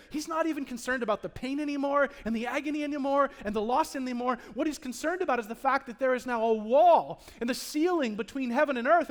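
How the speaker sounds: ordinary talk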